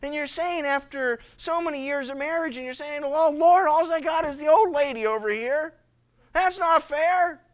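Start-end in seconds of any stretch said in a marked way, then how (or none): 3.0-7.4 high loud sulky whine; whining complaint. Acted. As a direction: grumble to God about your lot. Husband complains to God.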